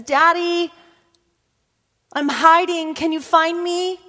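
put-on high, small child calling daddy; girl calling her daddy; little girl's voice. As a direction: call out like a four-year-old playing hide and seek. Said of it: "she says".

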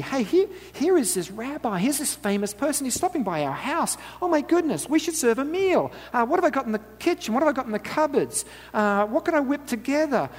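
high, flustered inner voice of Martha. Acted. Preacher voicing Martha panicking over a surprise guest, flustered and high. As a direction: rattle through her panicked kitchen thoughts.

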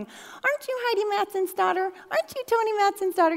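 high-pitched mock recognition; acted high singling-out question; imitated nosy acquaintance. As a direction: ask it high, as someone who keeps spotting a familiar face. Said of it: "without someone going".

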